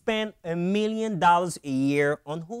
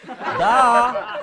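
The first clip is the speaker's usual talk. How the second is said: loud, higher, drawn-out duh; mocking duh; 6 semitones higher, 7 dB louder than usual. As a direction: say it like it's blindingly obvious. Mocking an obvious answer.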